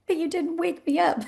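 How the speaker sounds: high, laughing imitation of a child's complaint; hurt little daughter. Six-year-old daughter accusing mom after election night, high and wounded. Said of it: "She was like".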